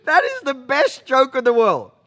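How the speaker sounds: loud, high voice breaking into laughter; laughing hard. Real, not acted. Teller cracks up at his favorite joke, laughing. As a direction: speak through a real fit of laughter.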